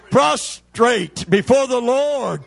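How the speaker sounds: "prostrate" said slowly with a laugh; "prostrate" drawn out and corrected; corrected word. Real, not acted. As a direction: slowly spell out "prostrate" through a chuckle. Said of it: "pros-, not prostate".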